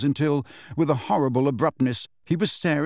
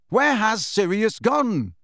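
yelling; 8 semitones higher, slower than usual. Acted. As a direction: yell it at a retreating back. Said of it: "Harry yelled after the elf".

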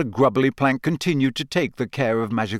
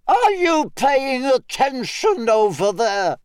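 much higher, slow teacher calling across a field; carrying teacher's call; 13 semitones higher, 3 dB louder, slower than usual. A narrator's reading. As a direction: call out sternly to the distant boys. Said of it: "Professor Grubbly Plank's voice carried over".

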